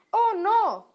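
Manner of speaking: high-pitched cry of dismay; alarmed cry. A narrator's reading. Little pig finds a fox at the door, alarmed cry. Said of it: "gritó el cerdito".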